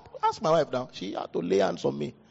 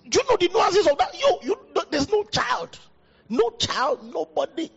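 impassioned preaching. Real, not acted. Pastor confronting churchgoers' excuses, high impassioned cry. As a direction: press the point with a breaking voice.

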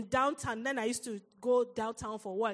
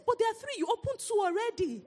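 pleading mom; 9 semitones higher than usual. Retold or imitated. Mom pleads with upset daughter.

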